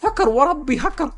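high-pitched, hammed-up shock; stunned villain squealing, drawn out at the end; shocked disbelief. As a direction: cry cheat in comic disbelief. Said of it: "زعيم الشياطين مصدوم".

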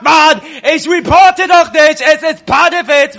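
loud, high, indignant protest; furious gamer shout; angry shouting. Real, not acted. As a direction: yell your innocence at the team. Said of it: "hört auf zu schreien".

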